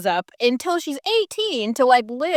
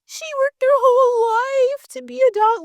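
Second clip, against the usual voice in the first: high, voice breaking into a cry; tearful mother. Retold or imitated. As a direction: imitate a grieving mother, choking up. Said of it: "her mom getting on and being like".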